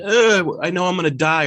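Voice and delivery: high, half-hearted imitation of a panicked wail; mock freaking out. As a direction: retell her panic with a light hammed-up whine. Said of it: "freaking out and crying and stuff".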